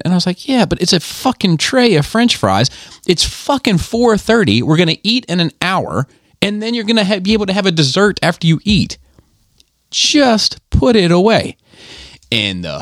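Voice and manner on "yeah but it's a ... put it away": exasperated scolding. Retold or imitated. Retold scolding of daughter.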